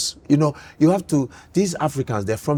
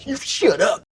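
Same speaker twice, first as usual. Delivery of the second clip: ordinary delivery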